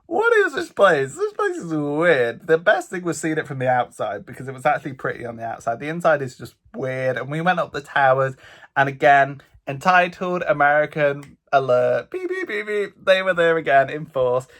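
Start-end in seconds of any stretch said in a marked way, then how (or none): 0.1-2.4 high, slow, baffled disbelief; bewildered. Retold or imitated. Vlogger recalling the odd mansion, slow high bafflement. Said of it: "and it's like". 12.1-12.7 high beeping like a warning siren; mock alarm beeps. Vlogger jokingly sounding an entitled-tourist alarm, high mock beeps.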